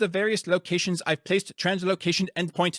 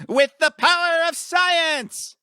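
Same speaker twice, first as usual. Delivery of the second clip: high, loud, slow triumphant cry; battle cry; 13 semitones higher, 3 dB louder, slower than usual. Acted. Scientist charging into the fight, triumphant cry. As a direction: proclaim it to the heavens.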